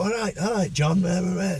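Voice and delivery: high, sing-song imitated street greeting; hamming up the friendly hello; mock cheery greeting. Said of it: "So when we go".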